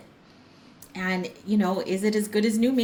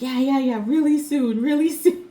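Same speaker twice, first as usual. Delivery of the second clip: imitating the mover, higher and louder, dismissive; mock breezy reassurance; 7 semitones higher, 9 dB louder than usual. Mover lying about arriving soon, mock breezy and higher. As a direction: play the late mover brushing her off, breaking into a laugh.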